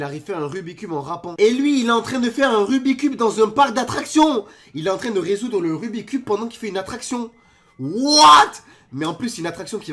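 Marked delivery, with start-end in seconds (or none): 1.4-4.4 loud, high, excited disbelief; amazed shouting. Real, not acted. Reacting to theme-park solve, amazed shouting. 7.8-8.5 high, very loud outburst; shocked shout. Real, not acted. Reacting to theme-park solve, shocked shout.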